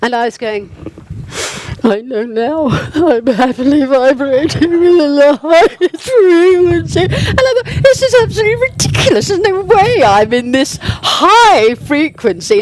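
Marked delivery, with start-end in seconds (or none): 1.8-7.0 high, loud wailing mock-cry, breaking into laughter; tearful low mood. Woman forcing her affirmations in a low mood, mock-tearful wail. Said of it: "I was in a really low mood". 7.0-12.6 very high, loud mock-wail; tearful exasperation. Woman recalling her failed affirmations, tearfully exasperated mock-wail. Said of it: "I was in a really low mood".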